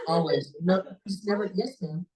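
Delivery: ordinary talk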